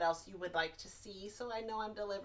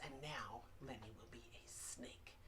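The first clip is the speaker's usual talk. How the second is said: hushed whisper for the reveal; 7 semitones lower, 15 dB quieter than usual. Acted. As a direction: drop to a secret whisper. Hushed announcement of snake turn.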